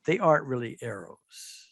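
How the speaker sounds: normal talking voice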